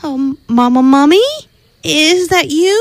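put-on high hopeful child's question; hopeful call. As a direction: rise high, hoping it's mama. Baby hears noise in swamp.

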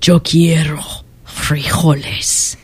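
low somber half-whisper, slow. Acted. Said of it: "in his most somber voice".

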